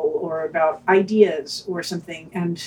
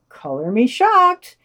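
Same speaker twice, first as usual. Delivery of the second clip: sarcastic; 5 semitones higher, 5 dB louder, slower than usual. Acted. Sarcastic remark on British news.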